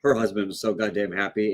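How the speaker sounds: normal talking voice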